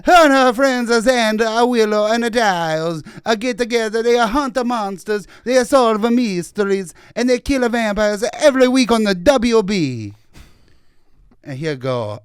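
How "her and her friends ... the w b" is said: higher and louder in a hammed-up Italian accent, every "uh" kept. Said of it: "I can't do it in the Italian accent".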